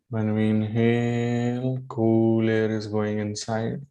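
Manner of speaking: measured instructions with long pauses, drawn-out key words; unhurried yoga meditation guidance; guided meditation. Real, not acted.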